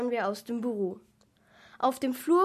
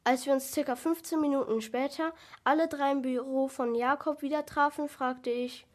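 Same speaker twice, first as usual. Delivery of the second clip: plain reading voice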